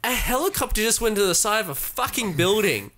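high-pitched exasperated disbelief; incredulous rant. Real, not acted. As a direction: marvel indignantly at the absurdity. Ridicules characters' calm after crash.

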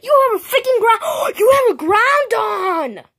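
high, loud amazed shout; high loud amazement; excited. Real, not acted. Kid amazed at friend's toy.

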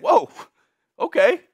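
normal talking voice

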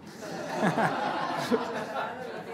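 a quick airy laugh; soft breathy laugh. Real, not acted. Trainer laughing at his own eating joke, airy laugh. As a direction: laugh lightly under the breath.

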